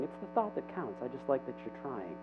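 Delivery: soft, indulgent voice dropping away; gentle God. Acted. God to a trying three-year-old, soft reassurance. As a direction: reassure softly, like a fond dad. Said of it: "God's saying".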